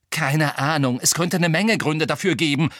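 loud, heated answer; hurled retort. A narrator's reading. Harry flings an answer back at Hermine, angrily. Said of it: "schleuderte ihr Harry entgegen".

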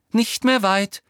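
high, loud call. A narrator's reading. Hermine answering Umbridge behind her, calling out. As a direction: call it back brightly ahead. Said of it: "rief Hermine".

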